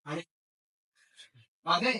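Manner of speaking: normal talking voice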